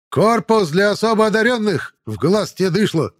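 loud, high barking sergeant; shouting drill sergeant. A narrator's reading. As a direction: bellow at the new recruits. Sergeant yells at recruits.

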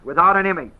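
"Without" leaned on, falling at the end; "Without" stressed, the rest light. Shown as a demonstration. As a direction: lean on "Without" then let it drop. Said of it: "That's how it's said".

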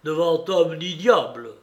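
loud, forceful rallying harangue; shouted defiance. A narrator's reading. Silver daring dead Flint before his crew, loud defiance. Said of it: "cria-t-il".

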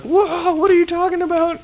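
very high, loud startled squeal; mock alarm. Girl confronted by a monster at the door, mock alarm. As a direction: yelp it in exaggerated shock.

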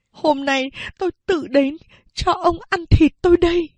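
a hint of defiant woman; defiant mother. A narrator's reading. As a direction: spit out the offer of herself. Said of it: "Mẹ nói tiếp".

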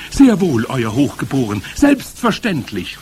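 loud, high, servile crowd reply; obedient calling. A narrator's reading. Witch audience answering their leader, obedient calling. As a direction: call back eagerly and submissively. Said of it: "riefen sie".

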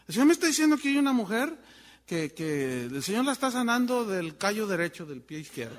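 fast parody of a radio faith healer; mock radio preacher. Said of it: "los de la radio".

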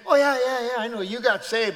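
loud, high scoffing; mock dismissiveness. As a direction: brush off the new convert loudly. Doubters dismiss the new convert.

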